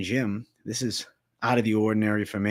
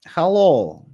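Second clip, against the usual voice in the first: put-on Jersey accent; 5 semitones higher, 6 dB louder than usual. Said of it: "I'm really gonna perfect my Jersey accent".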